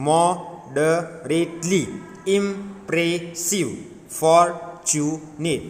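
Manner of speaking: very slow "moderately, impressive, fortunate", broken up; syllable by syllable. Shown as a demonstration. Teacher showing pupils how to read, slowly syllable by syllable. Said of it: "Like this one you are read".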